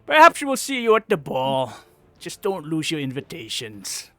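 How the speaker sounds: scornful goblin farewell; dismissive hobgoblin. Acted. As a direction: brush them off and leave.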